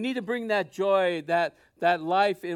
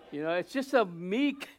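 ordinary talk, nothing marked

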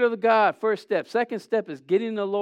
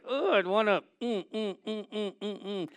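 soft, strained mm moans through clenched teeth; half-hearted mock anguish groans; anguished moaning; 2 semitones higher, 6 dB quieter than usual. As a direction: moan quietly as if biting down. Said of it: "I go like this with my hand".

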